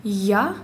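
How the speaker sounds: first contrast sound: open «я». Shown as a demonstration. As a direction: open Russian-like «я». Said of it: "Сравните".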